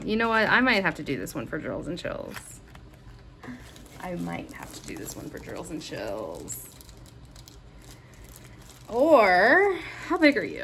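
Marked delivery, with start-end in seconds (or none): 4.0-6.7 low, musing repeat. Real, not acted. Repeats it slowly to herself.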